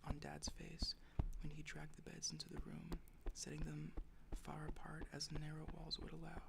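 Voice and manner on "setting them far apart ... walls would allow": ordinary delivery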